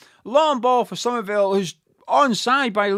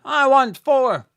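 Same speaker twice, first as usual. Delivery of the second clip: eager demand; 5 semitones higher, 6 dB louder, slower than usual. Real, not acted. Fan demanding another goal.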